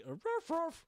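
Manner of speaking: high, yappy mock barks; imitated dog bark. Host copying the big dog bit, barking.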